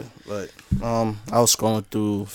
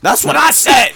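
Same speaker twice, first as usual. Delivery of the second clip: high, loud exclamation; emphatic agreement; 7 dB louder than usual. Real, not acted. Podcaster jumping in on cohosts' verdict, emphatic shout. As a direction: shout it out in vindication.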